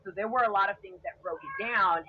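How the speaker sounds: ordinary delivery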